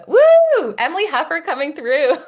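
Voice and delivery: high, loud whoop of welcome; loud, high woo for Emily; excited cheer. Real, not acted. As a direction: cheer her arrival like a fan. Cheering a guest joining in.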